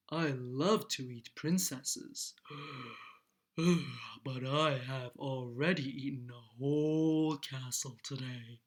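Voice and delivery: deep storybook dragon voice, heavy breaths between phrases. A narrator's reading. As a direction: play a big lazy dragon, savoring each word. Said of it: "The dragon stuck his nose out ... and said".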